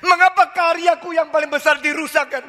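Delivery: high, strained protest; a believer's bitter lament to God; complaining to God. Retold or imitated. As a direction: cry out accusing God of ruining the dream. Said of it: "waktu engkau mengatakan".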